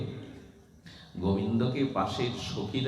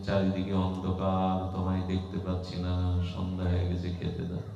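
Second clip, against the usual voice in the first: put-on eyes-closed child. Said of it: "চোখ বন্ধ করে বলছেন". (ধীরে, চোখ বুজে শিশুর ভান)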